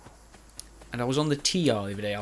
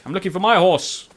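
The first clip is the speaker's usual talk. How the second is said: mock call; 7 semitones higher, 8 dB louder than usual. Calling out for his horse.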